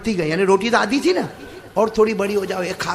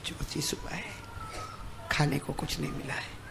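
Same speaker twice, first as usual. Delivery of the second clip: hushed repeat; 5 semitones lower, 17 dB quieter than usual. Shown as a demonstration. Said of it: "ساتھ میں بولو نا".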